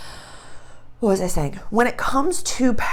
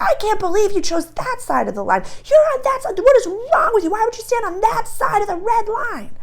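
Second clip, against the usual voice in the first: mock outraged yelling; 13 semitones higher than usual. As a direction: shriek in disbelief at the other side. People screaming across the line.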